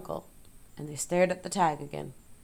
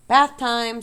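cheerfully; 5 semitones higher, 9 dB louder than usual. Acted. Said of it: "said Zuckerman cheerfully".

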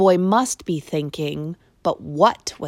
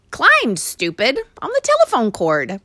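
rude retort; 6 semitones higher, 4 dB louder than usual. A narrator's reading. Mouse snapping at the boy, rude. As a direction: talk down to him. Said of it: "regretted his rudeness".

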